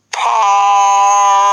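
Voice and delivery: loud, high parp of a horn; horn blast. Acted. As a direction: blare like a war horn.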